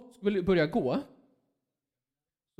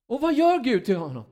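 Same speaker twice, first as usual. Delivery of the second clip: ordinary talk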